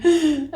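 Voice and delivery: high mock-sobbing gasp; tearful gasp. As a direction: gasp as if bursting into tears. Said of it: "I start crying".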